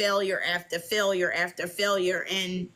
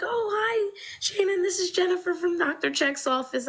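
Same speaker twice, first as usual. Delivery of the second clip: high, chirpy office phone voice; bright receptionist; 12 semitones higher than usual. Retold or imitated. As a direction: sound like a cheerful nurse calling. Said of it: "She was like".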